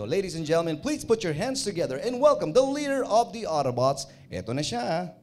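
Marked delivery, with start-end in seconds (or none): 0.0-4.1 loud, high emcee introduction; booming stage announcer; show host intro. Shown as a demonstration. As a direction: hype up the crowd like an emcee. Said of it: "Sige na nga".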